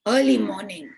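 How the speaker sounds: ordinary delivery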